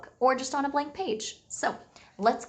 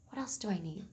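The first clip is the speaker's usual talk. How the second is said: quiet wondering; 10 dB quieter, faster than usual. Pretend. Teacher pondering aloud to class.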